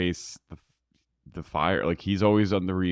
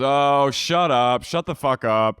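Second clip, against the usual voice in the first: drawn-out, louder, higher mock outcry; mock outraged shouting; 6 semitones higher, 7 dB louder, slower than usual. Fed-up cast cutting off Sandoval's apology, mock outraged shouting. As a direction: shout him down, imitating a fed-up group.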